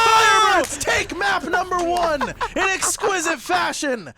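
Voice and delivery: high, loud hyped victory call; excited shout. Real, not acted. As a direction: shout the map win. Map-winning round, live call.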